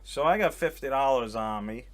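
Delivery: ordinary talk, nothing marked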